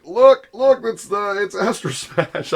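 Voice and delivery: "Look, look" called out high and loud in excitement; excited. Retold or imitated. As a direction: point and shout like a thrilled kid spotting the game. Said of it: "I'm getting all excited".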